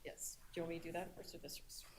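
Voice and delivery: hushed aside, barely voiced; whispering. Real, not acted. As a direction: whisper it off-mic to a colleague. Aside during the ceremony.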